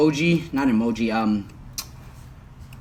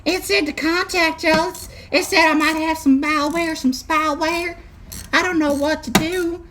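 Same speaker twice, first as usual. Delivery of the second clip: much higher put-on voice of a clueless old country man; 13 semitones higher than usual. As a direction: play a confused old-timer calling tech support. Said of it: "[name] does multiple voices".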